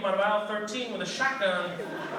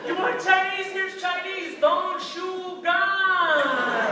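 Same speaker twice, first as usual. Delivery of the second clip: high, loud mock begging; hammed-up desperate appeasement; panicked pleading; 12 semitones higher, 7 dB louder, much slower than usual. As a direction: wail the last words out long. Begging imagined cop not to shoot.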